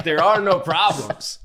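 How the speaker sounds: put-on slow Jamaican accent. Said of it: "How was my Jamaican accent".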